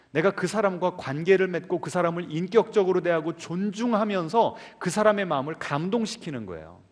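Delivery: usual speaking voice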